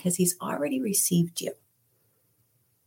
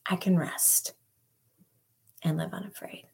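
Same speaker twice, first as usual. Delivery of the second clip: whispered prayer; 2 semitones higher, 4 dB quieter than usual. Pretend. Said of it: "let's be those who can say".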